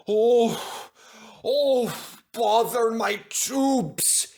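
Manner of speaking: high, slow out-of-breath complaint; panting. Acted. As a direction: pant through it, short of steam. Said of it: "he panted".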